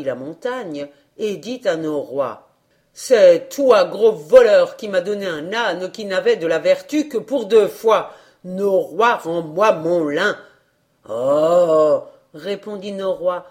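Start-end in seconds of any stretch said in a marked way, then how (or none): 2.9-10.4 put-on loud, indignant old man; angry accusation. A narrator's reading. Old man confronting the wind that cheated him, loud and angry. 11.1-12.0 loud, long drawn-out 'oh'; wind's reply. A narrator's reading. The wind answering the old man, booming.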